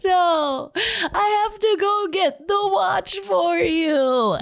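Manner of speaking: put-on high, slow tearful plea of a little girl; crying child. Retelling the girl's tearful reply to her mom, high and slow. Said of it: "through tears, she's like".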